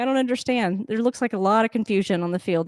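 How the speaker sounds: normal talking voice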